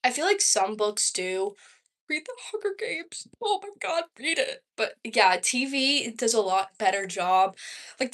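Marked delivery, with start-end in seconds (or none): 2.1-4.6 much higher gushing recommendation; high-pitched fangirl plea; excited urging. Real, not acted. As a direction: beg them to read it, all enthusiasm. Urging viewers to read a book.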